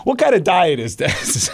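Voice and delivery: slow, very high punchline; comically outraged, about to crack up; stretched-out, high-pitched disbelief; mock incredulity. Acted incredulous punchline before laughing.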